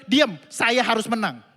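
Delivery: loud angry retold outburst; loud insistent snap; angry shout. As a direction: shout it to win the argument. Preacher replays his outburst.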